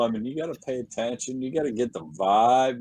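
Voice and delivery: usual speaking voice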